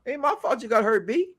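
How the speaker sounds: high, complaining voice of the injured player; mock whiny contestant. Mimicking a contestant griping about being targeted. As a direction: pitch up and whine about the leg.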